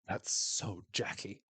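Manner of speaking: slow, low, scandalized deadpan; lightly imitated scandalized remark. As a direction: drop it slowly like gossip you can't believe.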